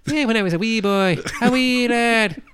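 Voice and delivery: very high, loud hammed-up Irish lad voice; mock Irish accent. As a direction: squeal it like a wee Irish boy. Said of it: "Or maybe he's like".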